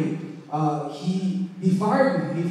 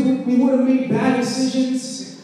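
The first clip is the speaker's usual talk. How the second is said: raised, ringing preacher voice; preaching at full force; 4 semitones higher, 5 dB louder than usual. Acted. Pastor urging the congregation, full preaching voice. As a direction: preach it out to the whole room.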